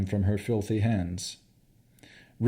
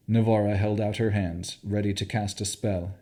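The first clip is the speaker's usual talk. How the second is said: ordinary delivery, nothing marked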